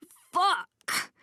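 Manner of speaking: high, sudden yelp of pain; pained cry. A narrator's reading. Kicked man to the woman, a pained cry. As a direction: cry out as you double over. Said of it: "he cries as he reels".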